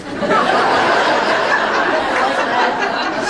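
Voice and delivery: high breathless laugh; real burst of high laughter. Real, not acted. Telling the crowd about her separation, a high laugh. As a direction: let a giggle burst out high.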